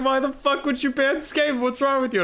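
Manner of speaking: high, laughing mock rage; mock angry opponent. Streamer mocking angry opponent John F B, high laughing squeal. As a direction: squeak out the rival's outrage through laughter. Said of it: "He's like".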